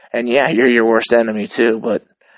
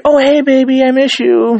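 put-on much higher, louder cooing hey baby; sugary sweet greeting; 7 dB louder than usual. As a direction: switch to cute lovey-dovey falsetto. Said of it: "and I'm like".

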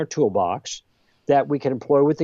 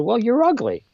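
much higher snappy jab; a playful imitation of a petty comeback; mock insult; 11 semitones higher than usual. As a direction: toss off a childish retort. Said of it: "I turned to him and said".

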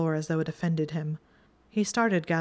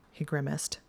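ordinary delivery

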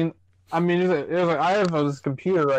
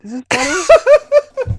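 laughing, much higher and louder; high laughing question; 12 semitones higher, 10 dB louder, much slower than usual. Pretend. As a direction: squeal the question through a laugh. Jokingly turns camera for friend.

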